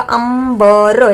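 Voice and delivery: अंबर slowly stretched, syllable by syllable; drawn-out pronunciation. Shown as a demonstration. Teacher showing the word's pronunciation, slow drawn-out अंबर. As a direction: hold each part of अंबर long. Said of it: "इसका उच्चारण क्या होगा".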